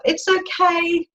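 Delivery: shouted up. Retold or imitated. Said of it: "the preacher shouted up".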